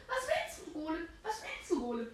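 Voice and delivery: squeaky high taunting voice; high mock taunt. Taunting the golem in a voice.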